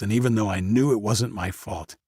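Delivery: plain reading voice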